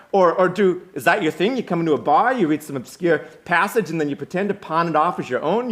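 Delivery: loud, high, cutting challenge; scornful confrontation. Acted. Will accuses the grad student of plagiarizing, heated and loud. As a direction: call out the show-off, piling up questions.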